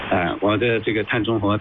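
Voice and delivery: ordinary delivery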